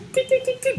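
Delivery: high quick tee tee tee; piping sound effect; squeaky tiptoe noise. Acted. Giant sneaking up, high squeaky tee sounds.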